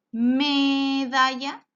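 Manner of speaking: slow, drawn-out sounding out; slow syllable by syllable. Shown as a demonstration. Teacher stretching the word for a young learner, slowly. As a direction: lay the syllables out one by one. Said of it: "¿Cuál es su primera sílaba?".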